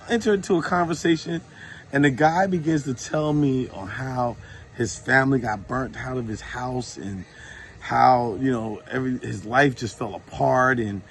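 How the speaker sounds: ordinary delivery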